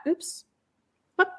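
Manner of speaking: ordinary delivery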